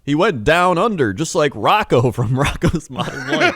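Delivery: put-on high, bright-eyed kids' TV announcer. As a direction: sell it like a Nickelodeon host talking to kids. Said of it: "They're like".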